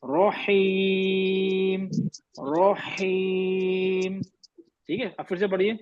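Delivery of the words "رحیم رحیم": رحیم chanted very slowly with heavy ro; drawn out recitation. Shown as a demonstration. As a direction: stretch رحیم in slow recitation. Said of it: "رو heavy ہو گیا".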